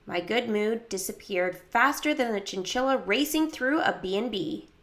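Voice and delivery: ordinary delivery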